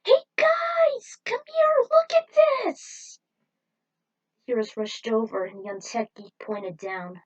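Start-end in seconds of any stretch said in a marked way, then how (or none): 0.0-3.2 very high, loud cartoon voice calling out; squeaky call. Small geek calling the heroes over to look, squeaky and loud. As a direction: shout for everyone to come look.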